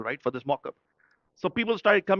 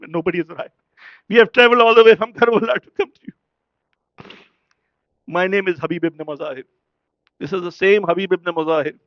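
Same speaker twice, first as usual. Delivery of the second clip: preaching at full force; 3 semitones higher, 8 dB louder than usual. Acted. Preacher to the congregation about the martyrs of Karbala, fervent and loud. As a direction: proclaim it to the whole gathering, voice swelling.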